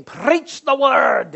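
put-on loud, drawn-out Scottish accent, pitched high; Alistair Begg. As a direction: imitate a Scottish preacher proclaiming, very slowly. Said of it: "in his Scottish accent".

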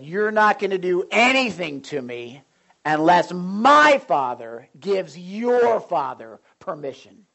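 defiant declaration. Retold or imitated. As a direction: stand firm and proclaim it slowly. Retold defiance to gang.

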